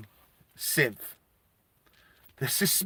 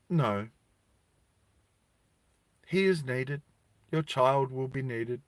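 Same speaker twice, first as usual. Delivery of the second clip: soft, measured refusal; understated, quiet authority; unmoved official; 6 dB quieter than usual. Acted. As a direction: lightly play an official quietly refusing to budge. Synth official refuses the mother.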